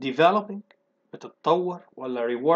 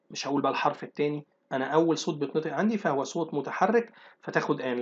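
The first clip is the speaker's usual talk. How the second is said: normal talking voice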